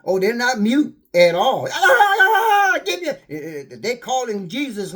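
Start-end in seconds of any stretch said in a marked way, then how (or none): 1.7-2.7 mocking laugh. Mocking the damned in hell.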